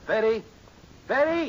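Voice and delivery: loud high call across the house. Acted. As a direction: shout her name from the den. Father calling daughter from another room.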